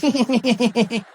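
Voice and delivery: weird staccato chuckle; long rapid mock chuckle; George Bush. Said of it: "that weird chuckly thing".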